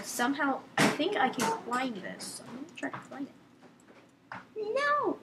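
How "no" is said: high, drawn-out no at normal volume; high-pitched no. Real, not acted. Player protests to himself mid-game, high-pitched no. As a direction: pitch the refusal right up high. Playful cry of refusal in game.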